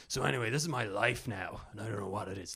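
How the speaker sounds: lightly moaning podcaster, trailing off; a touch of weary moaning; moany. Retold or imitated. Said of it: "a moany bit".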